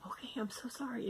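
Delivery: whispering a sheepish sorry; whispered apology. Retold or imitated. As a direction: whisper it, backing away. Husband apologizes, backing out.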